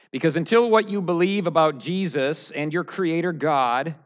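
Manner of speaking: loud, forceful preaching. Acted. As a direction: drive it home from the pulpit. Full-force sermon challenge.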